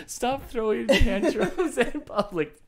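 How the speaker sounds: drawn-out words broken up by laughing. Real, not acted. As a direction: repeat the scolding while cracking up. Laughing echo of co-host's scolding.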